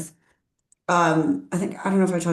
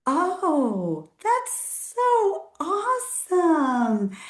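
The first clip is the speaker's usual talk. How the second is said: fake enthusiasm; 11 semitones higher, much slower than usual. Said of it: "I just go".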